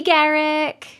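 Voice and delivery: usual speaking voice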